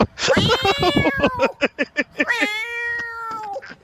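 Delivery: high-pitched helpless laughter; cracking up at his own story; long high laugh. Real, not acted.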